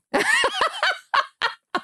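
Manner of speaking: sudden high-pitched laugh. Real, not acted. Laughing at her own warning.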